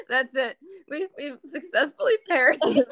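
high, laughing through the words. Real, not acted. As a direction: talk through the giggles. Laughing fit after joke.

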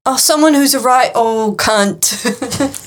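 slow, deliberately bad Cockney; bad Cockney accent. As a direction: lay on a terrible Cockney accent. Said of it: "I keep losing my accent".

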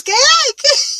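high, loud alarmed questions; jolted awake and alarmed; startled mom. Retold or imitated.

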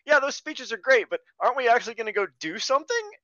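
high, fast, fed-up voice for the one sane guy; exasperated crew guy. Retold or imitated. Crewman cutting off the inspirational speeches, high and fast and fed up. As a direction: rush it out like the only practical one in the room. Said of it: "only one guy's like".

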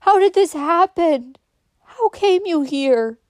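high, loud, drawn-out question; anguished cry. A narrator's reading. Amy on her knees by Kate, crying out. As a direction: wail the questions slowly in shock. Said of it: "she cried".

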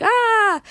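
sudden high mock scream of boredom. Teen retelling the ride to viewers, mock scream. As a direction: let out a fake bored "Ah!" on the ride.

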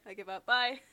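ordinary talk, nothing marked

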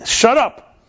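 sharp high shout of shut up; husband yelling. Retold or imitated. Neighbor mimicking the husband's yell, shouting. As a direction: bark it like an angry husband. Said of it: "the husband saying".

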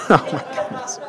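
breathy laughing aside; embarrassed laugh. Real, not acted. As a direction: laugh at your own blunder. Embarrassed after insensitive remark.

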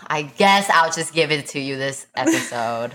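ordinary talk, nothing marked

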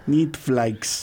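"Neat Flags" in a put-on Russian accent; mock Russian accent. Echoing the fake Russian Netflix joke. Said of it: "an attempt at Russian".

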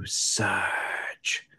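unvoiced soft sarge; quiet sarge. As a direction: breathe it out quietly. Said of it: "He goes".

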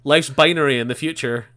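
loud mocking voice; mock pronouncement; mock loud quote. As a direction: blurt it out like a know-it-all. Mocking how everyone talks.

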